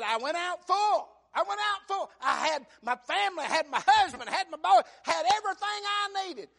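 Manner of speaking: high, loud wailing lament; Naomi's anguished cry. Acted. Preacher voicing Naomi crying to the townswomen, wailing. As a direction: cry it out from the pulpit.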